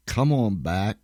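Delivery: normal talking voice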